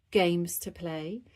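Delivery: ordinary delivery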